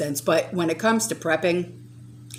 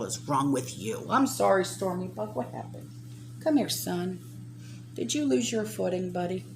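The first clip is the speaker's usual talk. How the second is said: tender to pet; 9 dB quieter than usual. Real, not acted. Speaking softly to pet.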